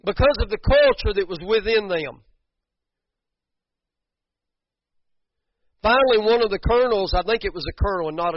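loud, high preacher's cry; full-force preaching. Acted. Preacher rallying the church about the fifty men, loud. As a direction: preach it out with fire.

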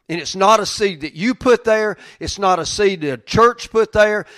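loud, high fervent preaching; preaching at full force. Real, not acted. Pastor urging the congregation, loud and fervent. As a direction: build it up and proclaim it.

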